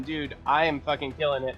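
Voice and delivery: ordinary delivery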